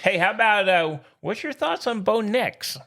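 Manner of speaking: ordinary delivery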